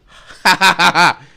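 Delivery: loud, high cackling laugh; real uproarious laughter, pitched high. Real, not acted. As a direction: burst into a big mocking laugh. Laughing at his friends' silence.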